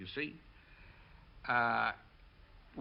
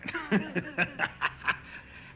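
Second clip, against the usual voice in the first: a breathy, voiceless laugh; real laugh; 5 semitones higher than usual. Real, not acted. Telling the interviewer his war story, a breathy real laugh.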